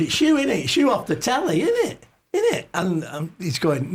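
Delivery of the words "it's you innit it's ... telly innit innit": very high, insistent "innit?"; imitating his tipsy self badgering Mel Smith, very high; tipsy starstruck fan. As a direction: nag him, squeaking higher on each innit. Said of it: "and I'm going".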